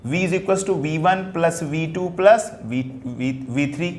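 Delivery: slow, writing while speaking; teacher writing an equation, slowly; slow dictation. Shown as a demonstration. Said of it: "लिख दिया हमने".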